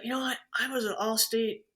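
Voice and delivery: ordinary delivery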